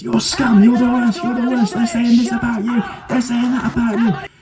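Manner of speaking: goading host. Retold or imitated. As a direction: bark insults to rile them up. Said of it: "He goes".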